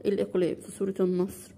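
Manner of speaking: normal talking voice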